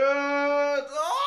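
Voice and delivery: long, high wail of dismay; drawn-out rising cry of disgust; frustrated scream. Real, not acted. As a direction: howl "oh" in exasperation. Spill on leg, socks, shirt.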